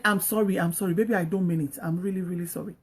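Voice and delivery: soft, low-pitched, contrite plea; playing a sorry husband, quietly; acted apology. Acting a husband's apology to wife.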